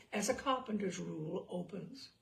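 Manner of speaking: plain reading voice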